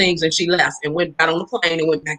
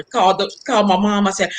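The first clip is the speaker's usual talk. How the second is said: usual speaking voice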